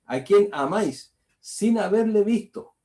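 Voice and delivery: slow and loud, with sin haberle visto pitched high; emphatic verse. Acted. Preacher hammering the verse home to his congregation, slow and loud. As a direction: lean hard on "a quien amáis" and drive "haberle" up.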